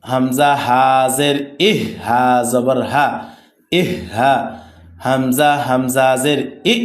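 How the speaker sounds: slow, measured syllable-by-syllable recitation; spelling drill. Shown as a demonstration. Teacher modelling Arabic letter spelling for students, measured drill recitation. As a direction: recite it as a steady drill, sounds drawn out.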